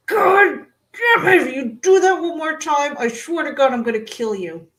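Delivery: acted-out outburst at a husband who won't stop; exasperated threat. Retells exploding at poking husband.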